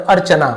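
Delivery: अर्चना in one quick piece, ar-cha-naa; crisp joined अर्चना; correct joined reading. Shown as a demonstration. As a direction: close the र straight onto च in अर्चना. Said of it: "ऐसे अर्चना नहीं पढ़ते".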